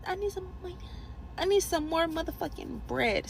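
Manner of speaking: ordinary talk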